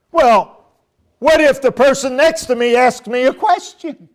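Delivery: high, loud whining question; mock a complaining churchgoer; whiny objector. Preacher mimicking a church member who wants to speak, whiny.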